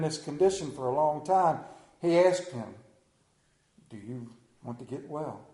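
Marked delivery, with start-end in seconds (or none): 3.9-5.5 gentle question. Acted. Jesus's question in scripture reading.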